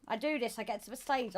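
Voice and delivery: usual speaking voice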